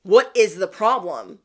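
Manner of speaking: ordinary delivery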